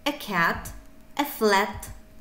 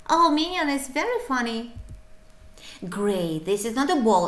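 ordinary talk, nothing marked

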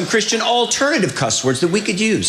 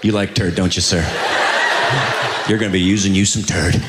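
low mock voice; 9 semitones lower than usual. Comedian mocks saying turd.

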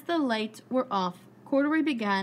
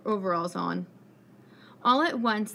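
plain reading voice

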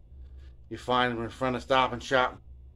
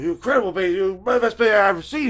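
raised, loud gushing praise; excited gushing; 8 semitones higher, 8 dB louder than usual. Retold or imitated. Darren gushing to Bobby G about his bass, excited. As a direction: rave about his bass playing, over the top. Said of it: "He's getting all excited".

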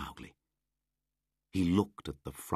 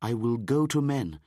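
put-on loud, lightly raised boy voice; resolute boy; 7 semitones higher, 6 dB louder than usual. Mowgli deciding to go to men, resolute. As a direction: declare it resolutely.

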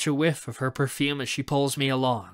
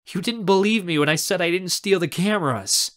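higher, indignant accusation; angry; 5 semitones higher, 4 dB louder than usual. Acted. Raz confronts uncle over cameras, angry. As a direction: accuse with raised voice. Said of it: "I say angrily".

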